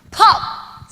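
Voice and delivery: mimicking the nose snapping; imitated pop. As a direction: spit out a sudden crack sound. Nose cracking sound.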